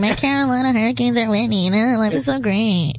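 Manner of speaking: lightly mimicking a gloating co-host; mocking Jason imitation. Co-host teasing Jason over his winning teams, mock gloating. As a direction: tease him with a mild impersonation. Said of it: "Is that what I sound like?".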